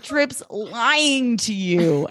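very slow, high put-on guy voice; hammed-up Hollywood heartthrob; Nate voice. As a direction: stretch "lying" way out. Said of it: "that voice for Nate".

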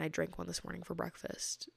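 breathy whispered confession; whispering. Real, not acted. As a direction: whisper it like a secret. Confessing drinking milk for breakfast.

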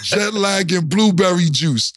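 normal talking voice

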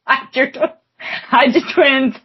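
high, laughing, slow chant; laughing meme quote. Real, not acted. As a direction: sing it out between laughs.

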